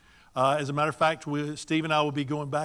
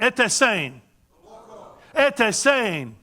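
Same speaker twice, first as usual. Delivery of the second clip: high, loud, hearty greeting; with gusto; 6 semitones higher, 5 dB louder, much slower than usual. Shown as a demonstration. Speaker leading the audience in a friendly greeting, with gusto. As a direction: call it out for the crowd to answer. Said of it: "they do it with gusto".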